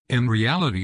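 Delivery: normal talking voice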